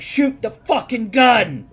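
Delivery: loud, high, drawn-out bellow; yelled order. Retold or imitated. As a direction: holler it slowly at full voice. Acting out the father yelling.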